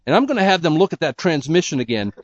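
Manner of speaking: a lightly voiced irritated Fred; Fred grumbling. Fred fuming about the transmission, mildly annoyed. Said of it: "And Fred is thinking".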